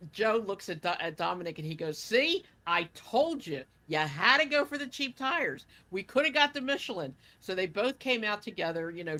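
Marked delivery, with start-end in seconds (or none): 2.0-7.1 retold scolding, higher and louder; scolding I-told-you-so. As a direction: throw the blame at him in a raised, imitated voice. Retold argument over cheap tires.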